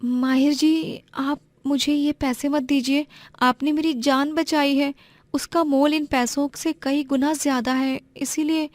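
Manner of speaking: a modest village girl, voiced high and gentle; young woman's voice. Narrator voices Aarohi.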